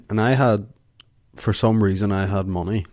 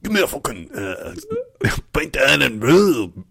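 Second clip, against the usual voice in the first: higher-pitched, badly faked Scottish accent; horrible Scottish accent; 5 semitones higher than usual. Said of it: "That's a horrible Scottish accent".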